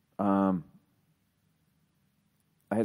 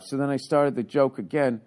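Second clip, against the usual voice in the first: ordinary delivery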